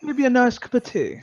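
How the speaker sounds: high, lilting mock-posh English; hammed-up English accent; playful British posh. Plays up English stereotype.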